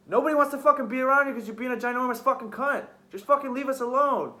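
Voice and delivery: high, heated telling-off; retold angry rant. Retelling telling her to leave them alone, angry outburst.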